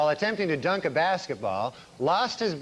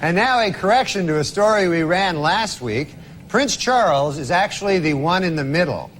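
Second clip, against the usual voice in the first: news anchor read; 7 dB louder than usual. Pretend. Mock news correction read.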